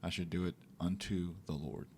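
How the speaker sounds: ordinary delivery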